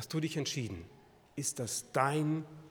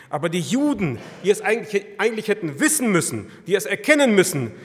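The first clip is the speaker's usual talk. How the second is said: loud, high, urgent preaching; preaching at full force; 5 semitones higher, 8 dB louder than usual. Real, not acted. Preacher pressing the congregation, loud and rising. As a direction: drive the questions home to the listeners.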